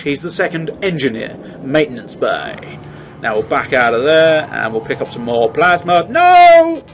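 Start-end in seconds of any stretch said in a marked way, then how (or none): 6.1-6.8 high loud shriek of no; mock horror. The game offers more plasma, and he shrieks no, high. Horrified outburst.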